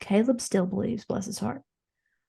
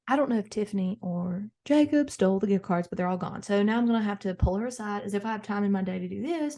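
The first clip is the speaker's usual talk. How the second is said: usual speaking voice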